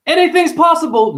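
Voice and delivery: squealed high and loud; a chirpy optimist's squeal; mock chirpy optimist. Mocking a sunny optimist, high and loud.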